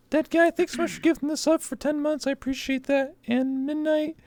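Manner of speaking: put-on very high cutesy anime cat girl; squeaky kitten voice thanking fans. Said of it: "I had to do that voice".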